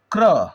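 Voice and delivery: क्र with a quick half क gliding into र; demo pronunciation. Shown as a demonstration. Teacher showing students how to say क्र, half क said fast. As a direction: clip the क and roll straight into र in क्र. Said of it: "आधा क को जल्दी से बोलेंगे क्र".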